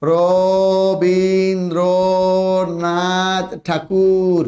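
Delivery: each syllable stretched very slowly; drawn-out spelling demo. Shown as a demonstration. Teacher showing a name's spelling, very slow and drawn out. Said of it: "এই যে দেখো".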